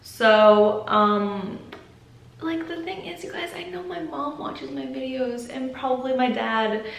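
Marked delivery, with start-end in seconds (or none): 2.4-6.8 higher, hushed confession; softly, uneasy admitting this; uncomfortable. Real, not acted. Vlogger admitting her mom watches these videos, uncomfortable and hushed. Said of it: "makes me a little bit uncomfortable".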